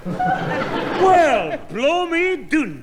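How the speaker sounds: high, drawn-out indignant outburst; exasperated indignation. Acted. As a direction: draw it out high, in comic disbelief. Grandfather exasperated at being interrupted.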